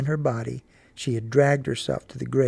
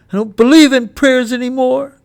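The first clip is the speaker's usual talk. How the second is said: put-on high, loud, breaking boy's voice; boy sobbing over his dead dogs; grieving boy; 11 semitones higher, 12 dB louder than usual. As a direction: cry it out to mama in protest. Boy rejects prayer.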